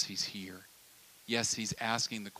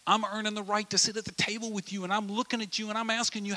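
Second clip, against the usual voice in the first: much higher, urgent pressing voice; insistent friend; 8 semitones higher than usual. Retold or imitated. Friend insists on answer, high and urgent. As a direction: press hard, won't look away. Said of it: "They're saying".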